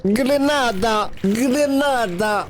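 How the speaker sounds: hamming up the grenade throw; mock battle cry. Lance une grenade en criant. (cri de guerre exagéré, très aigu et lent)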